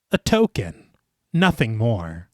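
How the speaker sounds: slick, smooth character line, dropping low at the end; lightly put-on smooth operator; smooth suggestion. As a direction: offer it suavely, in two separate phrases. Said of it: "why are you talking like that".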